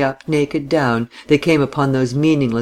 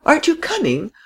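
much higher, more desperate repeat of the plea; alarmed cry. Acted. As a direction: call again, close to panic. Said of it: "cried the frail, excitable little lady".

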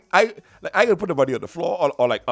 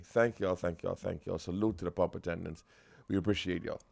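mock public tribute; 7 semitones lower, 6 dB quieter than usual. Mock announcement to pump attendants.

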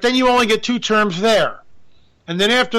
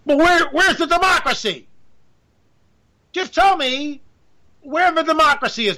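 higher, indignant plea for democracy; mock outrage; 8 semitones higher than usual. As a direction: demand it like a wronged citizen.

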